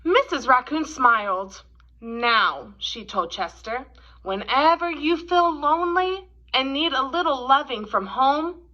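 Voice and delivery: gentle, expressive storybook read-aloud; reading to children. A narrator's reading. Teacher reading The Kissing Hand to kids, gentle storytelling. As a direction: read it like a picture book at story time.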